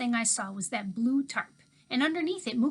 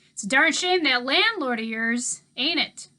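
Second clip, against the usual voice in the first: put-on louder Southern drawl of an old drifter; easygoing old man talking to kids; Mookie's voice; 4 semitones higher, 9 dB louder than usual. A narrator's reading.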